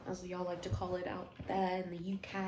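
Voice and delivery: clumsy attempt at a British accent; lightly put-on, badly faked UK accent. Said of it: "That was a horrible accent".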